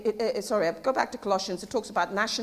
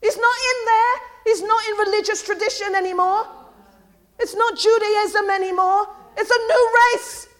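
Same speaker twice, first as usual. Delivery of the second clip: raised, loud preaching cry; vehement; 7 semitones higher, 6 dB louder than usual. Real, not acted. Preacher rejecting religious tradition, vehement. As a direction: declare it forcefully, high and insistent. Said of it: "That was vehement".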